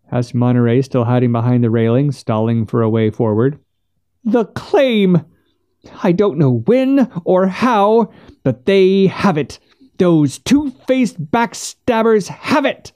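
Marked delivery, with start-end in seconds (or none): 4.2-12.9 high, slow, stretched-out indignation; outraged Missus Finch, crying out. A narrator's reading. Missus Finch telling Monterey of the theft, outraged cry. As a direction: wail the accusation high, dragging the words.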